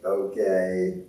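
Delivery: low, lightly voiced devilish okay; a hint of a sly inner devil; evil voice. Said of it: "like, evil voice".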